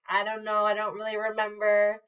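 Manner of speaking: normal talking voice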